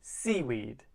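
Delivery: "Seaweed" with the beat on SEA; stress on first syllable. Shown as a demonstration. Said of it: "It's on the first syllable".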